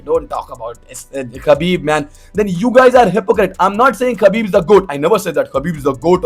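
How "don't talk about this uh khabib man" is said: low, soft, sulky fan voice, put on; an imitated fan muttering back at him; hushed mock fan. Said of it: "then like".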